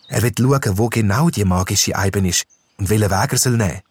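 ordinary delivery, nothing marked

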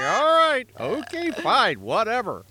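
loud, high, exasperated giving up. Retold or imitated. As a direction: throw up your hands, fine whatever. Mock giving in to refusal.

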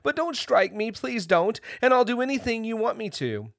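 high, frightened pleading; trembling voice. A narrator's reading. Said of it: "in a trembling voice".

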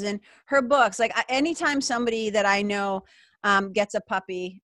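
ordinary delivery

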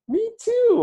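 a chirpy, overeager agreement; eager squeaky me too. Retold or imitated. Excited stranger matching answers.

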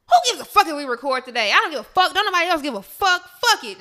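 loud, fast careless rant; reckless rant. Retold or imitated. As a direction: blow it off loudly. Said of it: "I text Tiffany some shit like".

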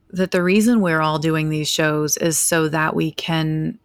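ordinary talk, nothing marked

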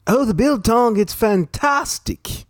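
high-pitched, hammy South African accent. Shown as a demonstration. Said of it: "That's my South African accent".

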